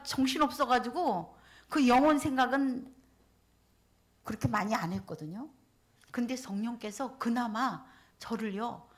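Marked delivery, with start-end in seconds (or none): none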